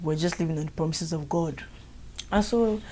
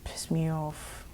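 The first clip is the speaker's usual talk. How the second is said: annoyed whisper; 2 semitones lower, 9 dB quieter, much slower than usual. Real, not acted. Annoyed aside.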